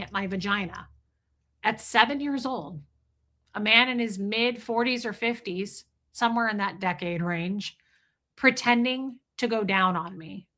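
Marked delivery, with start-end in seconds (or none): none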